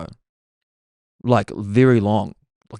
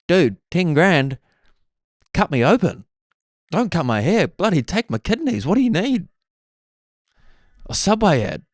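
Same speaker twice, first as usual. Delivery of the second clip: eager; 8 semitones higher than usual. Real, not acted. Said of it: "begging for the money".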